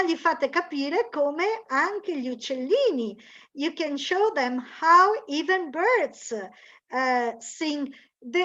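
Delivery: ordinary talk, nothing marked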